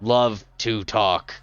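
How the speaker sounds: dry one-liner from a statue; deadpan gargoyle. Acted. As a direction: gargoyle claims he loves to talk.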